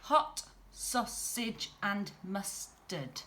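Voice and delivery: slow, each syllable beaten out; counting syllables. Shown as a demonstration. Teacher counting syllables for the class, slow and even. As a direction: tap out every beat of Hot, sausage and mustard. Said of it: "let's count them up".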